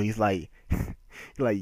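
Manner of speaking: ordinary talk, nothing marked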